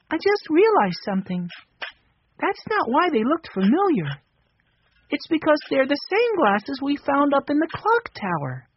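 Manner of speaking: put-on high, light little boy voice; young Benny. A narrator's reading. Narrator voicing young Benny.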